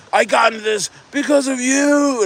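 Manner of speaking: high whiny mock voice of a blaming fan; mock accusing fan. Streamer mimics a fan blaming him, high mock whine. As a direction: whine it like a fan who lost money. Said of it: "people that are like".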